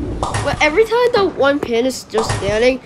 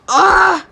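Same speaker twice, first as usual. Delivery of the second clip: high wailing "ah"; short high wail of frustration; frustrated yell; 8 semitones higher, 4 dB louder than usual. Real, not acted. Gamer after another bad roll, frustrated yell. Yells at a failed shot.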